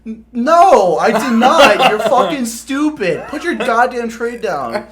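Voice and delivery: loud, high furious rant; drill sergeant yelling. Retold or imitated. Drill sergeant berating the recruit over the cornbread, yelling. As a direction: tear into him, loud and high. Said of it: "And he's like".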